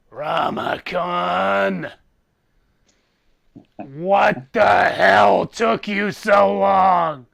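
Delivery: slow, loud, furious otherworldly voice; angry demon lord. Acted. GM voicing Abraxiel, roaring at Ramakan for being late. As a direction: Abraxiel bellowing at the late hero.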